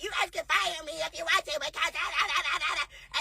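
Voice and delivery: a lightly voiced mocking impression, trailing into a laugh; mocking quote. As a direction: parrot her online post dismissively. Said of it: "now you wanna go on the internet".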